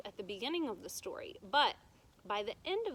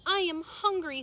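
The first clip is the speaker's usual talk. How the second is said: higher, louder plaintive princess; lost princess; 8 semitones higher, 7 dB louder than usual. A narrator's reading. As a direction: sound helpless and lost. Princess speaks to witch.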